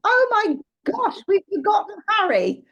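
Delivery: a flustered teacher who just realised, hammed up; mock panic. Teacher on the coach realising a child is missing, in mock panic.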